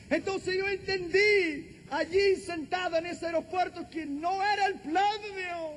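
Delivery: slow, high, ringing proclamation; impassioned preaching. Real, not acted. Preacher proclaiming God's word from Job, impassioned. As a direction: declare it solemnly, stretching the phrases upward.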